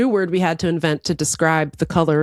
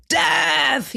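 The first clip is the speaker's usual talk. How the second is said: usual speaking voice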